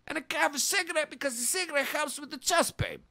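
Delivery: ordinary talk, nothing marked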